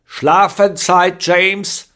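laut, hoch und langsam befehlend; herrisch. A narrator's reading. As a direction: wie eine strenge Tante rufen. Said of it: "Tante Gudruns herrische Stimme". (Tante Gudrun ruft James herrisch ins Bett)

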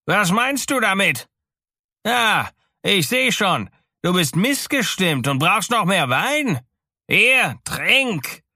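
high, loud, overbearing shouting; raging king's imperious yell; furious tyrant. A narrator's reading. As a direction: browbeat the listener at the top of your range. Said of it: "schrie der Tyrann wütend".

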